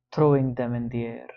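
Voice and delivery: ordinary delivery, nothing marked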